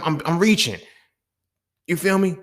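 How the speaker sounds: normal talking voice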